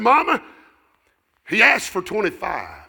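loud, high call to his wife; father calling out. Retold or imitated. Father calling to Mama in story.

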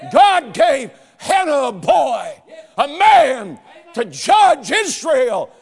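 loud, high, drawn-out preacher's cry; full-force preaching. Acted. Preacher crying out to the congregation for men. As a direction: call out to the congregation.

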